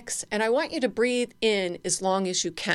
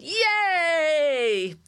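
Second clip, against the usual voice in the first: cheering yay; 9 semitones higher than usual. Shown as a demonstration. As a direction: throw your arms up and cheer. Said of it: "put our hands above our head and go".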